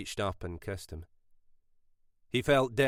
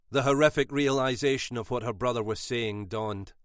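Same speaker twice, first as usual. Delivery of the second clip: usual speaking voice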